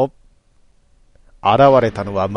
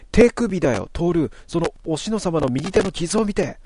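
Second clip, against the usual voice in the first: put-on higher girl's voice, pointing it out; urgent girl; 7 semitones higher than usual. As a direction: make Toru look at the wound now. Natsumi points at the wrist. (少女の声で急いで指摘する)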